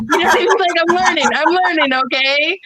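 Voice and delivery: real laugh while admitting fault. Real, not acted. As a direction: giggle it out, owning the mistake. Laughs at own loudness.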